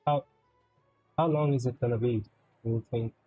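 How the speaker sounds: usual speaking voice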